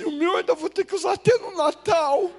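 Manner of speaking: put-on high, whiny child's wail about the torn trousers; crying little brother. Brother complains about wearing the outfit, in a high, tearful child's voice. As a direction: whimper it like a little boy on the verge of tears. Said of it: "E ele começou a chorar".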